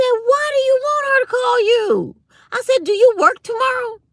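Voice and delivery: high, loud exasperated shout; heated retort. Retold or imitated. Retorting to a nosy coworker, high loud shout. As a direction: snap back at the nosy coworker.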